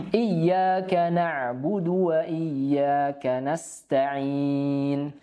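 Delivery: very slow chanted verse; Quran recitation. Shown as a demonstration. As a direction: intone the whole verse slowly.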